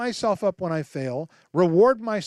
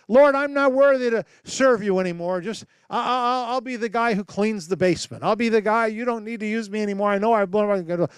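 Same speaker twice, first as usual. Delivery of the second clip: higher, pleading little voice; whiny self-abasing prayer; 4 semitones higher than usual. Acted. Believer begging God to be a mere servant, whiny. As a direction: grovel before God like a failure. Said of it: "when we talk like that to God".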